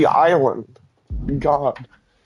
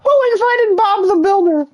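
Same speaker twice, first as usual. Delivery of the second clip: loud high-pitched shriek; 4 dB louder than usual. Acted. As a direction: shriek it at full volume. Louder repeat, higher shriek.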